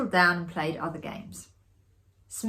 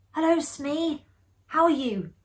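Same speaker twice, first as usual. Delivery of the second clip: a slightly higher, playful greeting; lightly voiced woman; 9 semitones higher than usual. A narrator's reading. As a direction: greet the ghost brightly. Narrator voices woman greeting Smee.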